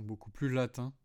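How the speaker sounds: ordinary talk, nothing marked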